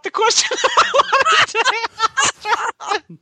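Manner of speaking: laughing in real embarrassment at the mistake; embarrassed laugh. Real, not acted. Host laughs at his own blunder while reading. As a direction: break into a laugh as you realise.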